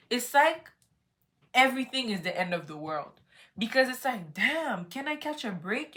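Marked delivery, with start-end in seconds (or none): 4.3-6.0 high, fed-up complaint; exasperated. Retold or imitated. Problems pile up on vacation, fed-up exasperation. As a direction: throw up your hands at bad luck.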